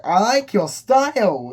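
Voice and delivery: high, loud, slow gush; mock admiration. Customers praising the pickle seller, loudly admiring. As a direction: call it out like an impressed fan.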